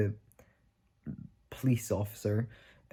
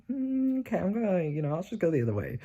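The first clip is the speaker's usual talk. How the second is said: awkward retreat; 9 semitones higher than usual. Retold or imitated. Backing away from squaring-up men.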